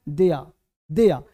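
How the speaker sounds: low, firm "दिया" with no r; correct sound shown. Shown as a demonstration. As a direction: give each "दिया" an open vowel ending. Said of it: "एक sound क्या है". ("दिया" नीचे सुर में, र के बिना)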